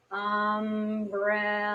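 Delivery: "umbrella" very slowly, each syllable stretched and slightly connected; drawn-out syllables. Shown as a demonstration. Teacher showing parents the example, "umbrella" slowly drawn out. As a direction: stretch "umbrella" out on one level note, syllable by syllable. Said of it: "say the word on the card slowly with the syllables drawn out".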